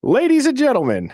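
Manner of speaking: mock grand announcer. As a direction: present it like a ringmaster. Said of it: "I don't know where that came from".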